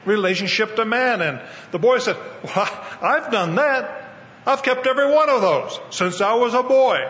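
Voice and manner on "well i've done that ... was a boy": a hint of a boy protesting his goodness; young boy's voice. Preacher voices the rich young boy boasting, mildly raised boy voice. Boy defends himself to Jesus.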